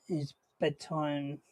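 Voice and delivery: a quiet tired scolding; hushed. Real, not acted. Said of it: "Some of us have work in the morning".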